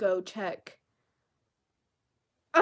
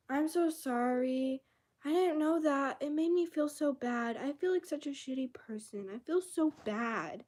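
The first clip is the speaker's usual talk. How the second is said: higher, whiny mock apology; mocking pity party; 5 semitones higher than usual. Mocking how Drippy apologized, whiny and high. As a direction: ham up the self-pity. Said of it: "is a pity party".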